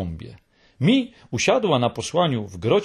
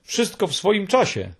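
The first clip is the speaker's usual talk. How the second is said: lightly cheerful hemulen, pitch up; a touch of jolly good humour; 7 semitones higher than usual. A narrator's reading. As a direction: say it breezily, pleased with yourself. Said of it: "odpowiedział paszczak wesoło".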